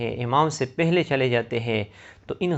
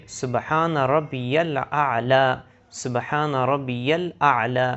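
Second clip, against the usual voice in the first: slow, measured سبحان ربی الاعلی; slow correct recitation; much slower than usual. Shown as a demonstration. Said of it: "اس طرح پڑھے جاتے ہیں". (سبحان ربی الاعلی صحیح، آہستہ)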